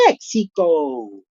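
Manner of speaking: drawn-out American Mexico; gringo-style Mexico, slowly; American accent. Retold or imitated. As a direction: say it like an American would, stretched slow. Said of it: "y no con el acento americano".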